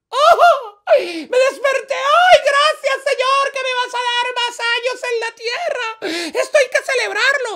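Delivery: very high, loud squealing celebration; ecstatic joy. Acted. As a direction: shriek your thanks, overjoyed and high-pitched. Woman wakes up and rejoices.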